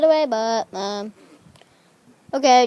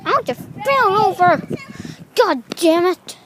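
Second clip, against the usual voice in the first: raised-pitch, annoyed grumbling; exasperated complaint; 6 semitones higher, 3 dB louder than usual. Real, not acted. Just fell over, exasperated complaint. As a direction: complain hotly, higher than usual. Complains about having fallen.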